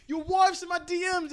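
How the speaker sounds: imitating Conor McGregor. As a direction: shout it like a cocky Irish fighter. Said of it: "Conor McGregor was like".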